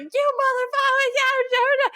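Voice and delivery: very high mock-furious screech; Buggy. Buggy answers Shanks's excuse, in a high hammed-up rage. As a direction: splutter in squealing cartoon outrage. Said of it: "he's like".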